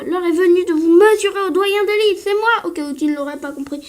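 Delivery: voix de vieux lutteur plus forte; annonce-toi en champion imposant; doyen tonitruant. Acted. (doyen qui tonne)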